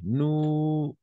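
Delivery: "Nous" lifted high and drawn out; stressed nous. Shown as a demonstration. Teacher modelling "Nous" for the student, high and drawn out. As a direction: sing out "Nous" high and long. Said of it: "on va substituer".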